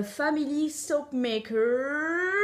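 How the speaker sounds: English accent, slowly drawn out, last syllable held long; over-the-top English accent. Shown as a demonstration. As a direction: show off a crazy English accent, stretching the words. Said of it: "t'as vu cet accent de ouf". (accent anglais exagéré, très étiré)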